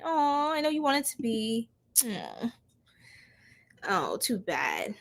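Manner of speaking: soft, dismissive fake pity; mock sympathy. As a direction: coo it softly as if sorry for a troll. Mock pity for blocked trolls.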